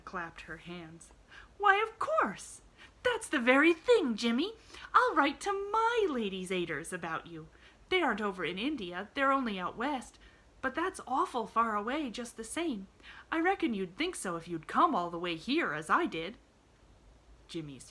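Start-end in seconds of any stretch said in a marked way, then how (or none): 1.6-2.6 high, loud, slow burst of joy; a child's sudden glee, much higher and louder; thrilled little girl exclaiming; delighted. A narrator's reading. Pollyanna clapped her hands. 3.0-16.4 lightly voiced young girl, pitched higher; a bright little girl, gently arguing; a hint of an earnest young girl; Pollyanna. A narrator's reading.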